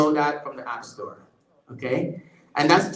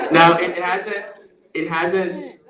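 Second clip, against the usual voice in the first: laughing; 4 semitones higher, 6 dB louder than usual. Real, not acted. Laughing after the AI's patois.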